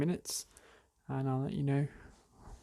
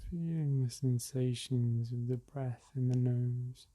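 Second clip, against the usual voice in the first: guided meditation; slower than usual. Acted. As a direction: ease listeners into the breath gently.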